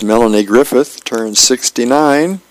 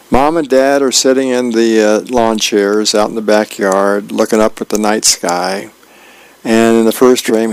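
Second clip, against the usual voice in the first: normal talking voice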